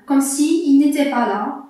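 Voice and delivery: ordinary delivery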